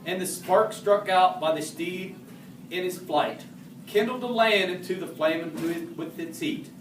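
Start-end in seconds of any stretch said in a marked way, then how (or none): none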